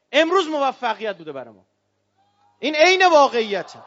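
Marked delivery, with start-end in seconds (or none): 2.6-3.8 louder, declaring it as fact; emphatic shout. Real, not acted. Preacher to the rally crowd, a loud emphatic shout.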